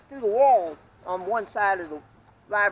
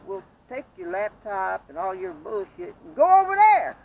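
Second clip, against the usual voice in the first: slow angry order; 3 semitones higher, 8 dB louder, slower than usual. Retold or imitated. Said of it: "I said".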